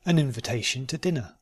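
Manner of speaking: "An invitation to dinner" run together quickly. Shown as a demonstration. Teacher repeating the practice sentence, quickly.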